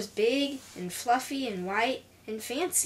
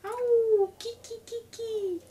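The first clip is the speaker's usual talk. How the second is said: animal cry; 11 semitones higher, slower than usual. Acted. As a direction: imitate a strange creature calling at night. Night animal noises in the story.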